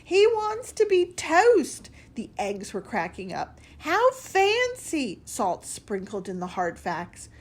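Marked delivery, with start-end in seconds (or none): 0.0-1.9 very high, loud, slow mocking squeal; mocking laugh. A narrator's reading. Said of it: "a snort was heard". 3.8-5.2 very high, slow, loud mock gasp. A narrator's reading. Eggs mocking Slice.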